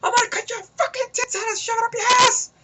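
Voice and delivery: pissed-off, excited screech, very high; hammed-up high-pitched raving threat; Bennett. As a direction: rant it in a wild, sky-high mock scream. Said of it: "he's pissed, kinda like Bennett".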